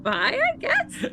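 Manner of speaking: squeaky high, laughing through it. Retold or imitated. Telling Brink goodbye in a laughing squeak. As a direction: giggle out a falsetto goodbye. Retelling their reply, laughing.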